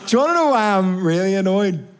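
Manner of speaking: high, exasperated complaint; too annoyed to finish the thought; comically worked-up irritation. Acted. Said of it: "I'm too annoyed about this".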